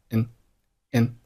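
"n" as a reduced "and" with silent d; weak form. Shown as a demonstration. Teacher showing learners the weak form, reduced "n". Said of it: "结尾的的不发音".

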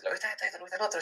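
lightly voiced, high friend asking questions; imitating a friend. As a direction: pitch it up to play the friend asking. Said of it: "me dice".

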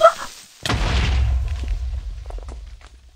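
long breathy gasp of fright; frightened gasp. Real, not acted. Creeper jump scare.

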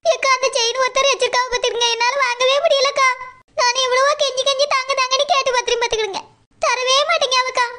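ordinary delivery

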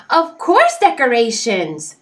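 high bright chirp; chirpy. A narrator's reading. Oxpecker insists on decorations while decorating. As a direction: chirp it happily. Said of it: "chirped Oxpecker".

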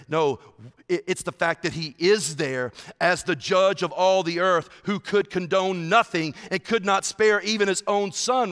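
ordinary talk, nothing marked

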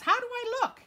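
high, loud eager question; proud boy. Acted. Boy in spacesuit asking mom, high eager question. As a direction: show off the spacesuit. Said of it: "he says".

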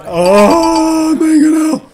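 exasperated wail. Real, not acted. Streamer losing it at the scenario, wailing. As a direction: wail it in despair.

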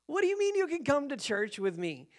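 incredulous. Retold or imitated. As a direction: question it as if it makes no sense. Retold disbelief at her offer.

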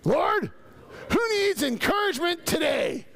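shouted out, pitch up high; loud shout. Shown as a demonstration. Pastor asks the congregation to say it like that guy, loud shout. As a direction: call it out like the guy who yelled. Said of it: "Say it like that guy".

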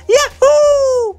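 put-on very high, whooping Mario-style Italian accent; exuberant, exaggerated Italian impersonation. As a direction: whoop it out in a squeaky Italian cartoon voice. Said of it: "impersonation of an Italian accent".

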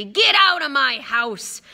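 loud, high furious roar; drawn-out angry bellow; Hagrid, furious. Acted. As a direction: order them out in a rage. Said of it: "said Hagrid furiously".

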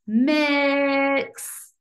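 "Mix" drawn out on a level vowel; stretched short I. Shown as a demonstration. Teacher confirming the short I, stretched vowel. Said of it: "Short I".

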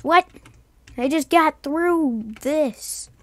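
stunned, slow disbelief; disbelieving. Real, not acted. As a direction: drag it out in disbelief. Bedrock broken unexpectedly.

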